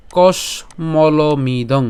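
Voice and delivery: কসমলমিদং drawn out very slowly, syllable by syllable; broken pronunciation. Shown as a demonstration. Teacher breaking কসমলমিদং into parts for students, very slow. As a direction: stretch কসমলমিদং slowly, pausing after the শ. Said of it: "তালব্য শ পর্যন্ত উচ্চারণ করলাম".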